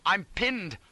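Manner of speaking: high, pained cry; high-pitched panic; distressed cry. A narrator's reading. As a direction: cry out as if trapped. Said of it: "I cried".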